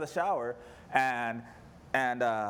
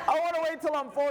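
even higher whining repeat of the plea; scared little boy; 9 semitones higher than usual. Retold or imitated. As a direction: whine it again, more desperate. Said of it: "He's saying all this stuff".

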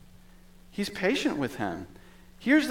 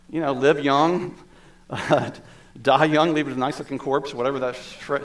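ordinary talk, nothing marked

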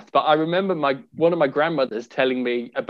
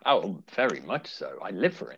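sarcastic; 9 semitones lower, 10 dB quieter than usual. Real, not acted. Co-host asked about hearing from listeners, sarcastic. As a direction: agree with sunken, unconvincing enthusiasm. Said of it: "that sounded sarcastic".

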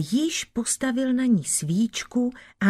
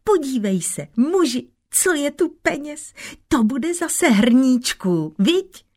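joyful calling; 7 semitones higher, 3 dB louder than usual. A narrator's reading. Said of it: "hned volala radostně".

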